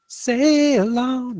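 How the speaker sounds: a hint of a lady pirate; female pirate voice. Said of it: "that was the female pirate voice".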